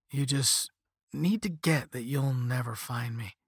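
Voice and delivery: plain reading voice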